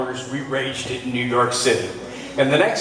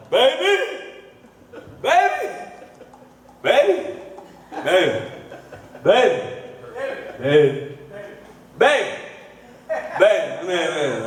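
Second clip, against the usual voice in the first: put-on drunk calling baby; 3 semitones higher than usual. Drunk comic in the video, calling out baby again and again. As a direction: wail baby over and over.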